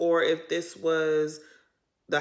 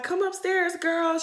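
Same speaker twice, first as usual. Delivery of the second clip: imitating a sing-song invite; 10 semitones higher than usual. Meredith invites Mary up.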